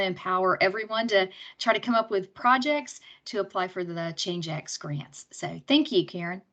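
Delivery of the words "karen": ordinary talk, nothing marked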